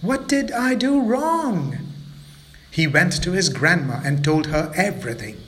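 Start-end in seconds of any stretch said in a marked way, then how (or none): 0.0-1.8 wistful wondering. Acted. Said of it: "he wondered".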